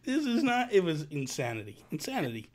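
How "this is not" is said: high, laughing can't-believe-it; laughing disbelief. Real, not acted. Retelling Spider-Man rolling into camera, laughing. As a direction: laugh through the words.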